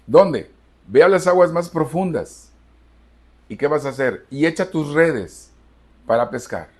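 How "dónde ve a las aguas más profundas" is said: "profundas" called out loud and high; loud emphatic preaching. Shown as a demonstration. Preacher to the congregation, repeating the Lord's order loudly. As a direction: ring it out like a command from the pulpit.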